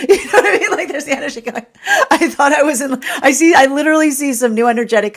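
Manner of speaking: talking through real laughter; high, loud, giggly; words bubbling out on a laugh. Real, not acted. Cracking up while voicing the energy.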